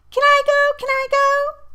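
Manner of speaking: put-on very high, loud child's plea; impatient squeal. A narrator's reading. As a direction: squeal like an eager kid. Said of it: "Dawn squealed impatiently".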